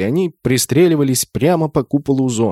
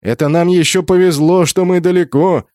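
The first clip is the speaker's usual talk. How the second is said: raised, wry character voice; higher, louder, amused relief; wry chuckling remark; 6 semitones higher, 4 dB louder than usual. A narrator's reading. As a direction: say it with an amused smirk. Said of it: "усмехнулся Шуруп".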